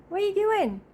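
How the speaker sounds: high, teasing voice to a pet; pet talk. Real, not acted. Catching her cat with its toy, high pet-talk voice. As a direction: fondly scold the cat in a high voice.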